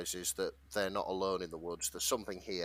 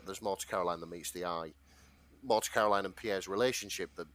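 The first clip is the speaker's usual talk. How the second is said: ordinary talk, nothing marked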